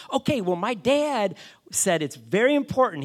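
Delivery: high, loud, cheery caller impression; Master Ian. Son relays his dad's request, cheery. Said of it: "He's, like, really cheery guy".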